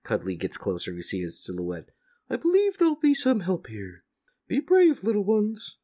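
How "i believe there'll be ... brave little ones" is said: high, far-off cow creature voice; a big gentle cow soaring up high; talking cow. Acted. Cow reassures the stranded little farmers in a high voice. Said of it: "says the cow".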